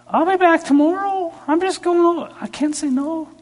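very high, mock pleading voice; high-pitched pleading. Excusing himself to jealous best friend Steve, squeaky mock pleading.

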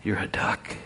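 soft whispered "You're a duck". Acted. The Lord Duck whispers to the little duck as they fly. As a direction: breathe it like a secret.

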